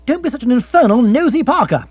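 sarcastic outburst at a prying partner; rant gathering momentum. Acted. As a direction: build up steam and tell her off. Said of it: "gathering momentum".

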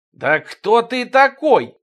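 high, loud, stretched-out challenge; drawn-out shouted question; indignant yell. A narrator's reading. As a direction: yell it slowly at the upstart. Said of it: "заорали на него водолаз и хорёк Тимоха".

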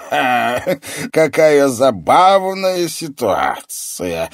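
loud, high, slow villain's amusement; mocking sorcerer's mirth; gloating laughter. A narrator's reading. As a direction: bark a laugh, then relish the words. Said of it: "Колдун снова расхохотался".

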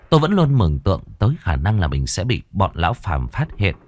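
ordinary delivery

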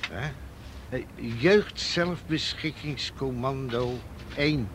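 slow, deliberate reading of a threatening letter; slow solemn read-out; reading a note aloud. Shown as a demonstration. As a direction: read it out word by word, slowly. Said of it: "Kun je hem lezen?".

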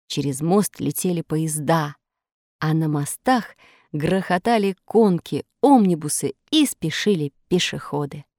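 ordinary delivery

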